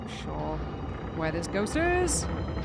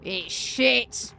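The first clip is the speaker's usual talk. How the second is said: imitating dad; 3 dB louder, much slower than usual. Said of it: "He was like".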